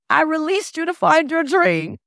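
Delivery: high, emotional retold confession; imitated tearful woman. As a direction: play the weeping former boss, pitched high. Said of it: "she's crying the entire time".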